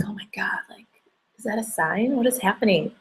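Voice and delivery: breathless whisper of wonder; stunned whisper. Retold or imitated. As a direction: whisper it as if afraid to jinx it. Inner thoughts seeing butterfly.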